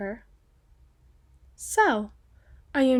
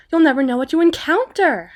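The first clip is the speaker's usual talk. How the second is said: raised, higher-pitched calling out; loud pointed warning; 5 semitones higher, 9 dB louder than usual. Acted. Escort warning a night-time walker, loud and pointed. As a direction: project a warning for all to hear.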